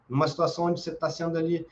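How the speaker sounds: ordinary talk, nothing marked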